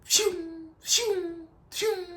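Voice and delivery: high, light whistling zips; imitated whizzing shots. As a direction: mimic bullets zipping past, high-pitched. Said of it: "I'm hearing, like".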